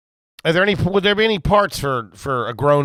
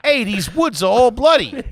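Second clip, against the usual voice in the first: high, hammed-up Brooklyn Italian; Brooklyn Italian accent; 7 semitones higher than usual. Shown as a demonstration. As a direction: play it as a Brooklyn Italian tough talker. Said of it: "That's my Brooklyn Italian".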